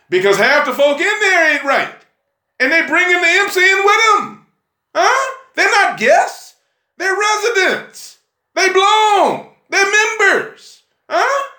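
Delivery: high, punchy pulpit declarations; worked-up church exhortation; fiery preaching. Real, not acted. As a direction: drive each point home in a high preacher's cry. Preaches at full force.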